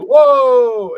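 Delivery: sudden high, loud burst of amazement; amazed whoa. Retold or imitated. Crowd watching the crossover, loud amazed whoa. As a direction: gasp it out like a stunned crowd.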